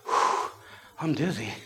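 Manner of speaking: soft blown-out breath, then quiet words; mildly spent, acted relief; catching breath. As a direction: imitate winded relief after crying. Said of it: "she had caught her breath".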